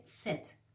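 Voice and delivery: ست with a t added before s, "tset"; soft ست beginning "ts"; t placed first. Shown as a demonstration. Teacher building on the Persian word, ست as "tset". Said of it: "یه ت هم اولش باید بذارید".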